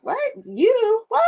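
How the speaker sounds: sudden high squeal on You; recreating the moment of recognition; shocked disbelief. Retold or imitated. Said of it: "and I was like".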